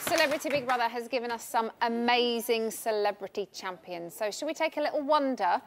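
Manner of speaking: presenter voice. Acted. Presenter hosting winners segment.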